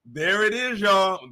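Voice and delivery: high gleeful gloating; excited. Real, not acted. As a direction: gloat over the find. Celebrating the discovery.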